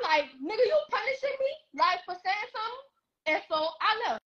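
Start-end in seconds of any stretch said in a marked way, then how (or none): 0.4-2.8 pitched way up, outraged question; indignant disbelief. Retold or imitated. A daughter protests as her mother takes her phone, high indignant disbelief. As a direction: throw it back at her, stunned.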